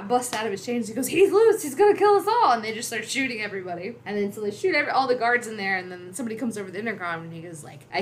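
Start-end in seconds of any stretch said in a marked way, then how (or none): 1.1-2.5 high, loud panicked warning; mock alarm. Han feigning alarm as the Wookiee breaks free, loud mock panic. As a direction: cry out in acted panic.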